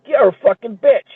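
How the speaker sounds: tossing off a nasty insult; mock insult. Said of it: "call her a bitch to her fucking face".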